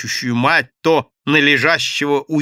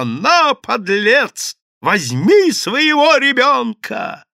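high, loud, drawn-out squeal of outrage; screeching; 12 semitones higher, 6 dB louder, much slower than usual. A narrator's reading. Madame thrusting the baby at the scoundrel, screeching. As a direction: screech the insult, stretching the final words. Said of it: "завизжала".

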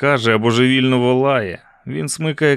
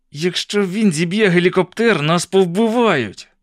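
high-pitched cry, drawn out at the end; panicked cry; 6 semitones higher than usual. A narrator's reading. Captive warns everyone they will be killed, panicked cry. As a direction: cry the warning in rising panic. Криків більше.

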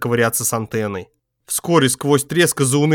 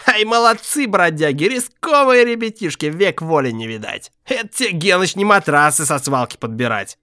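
put-on drunk streetwise man; 5 semitones higher than usual. A narrator's reading. Zhgut praises robbers.

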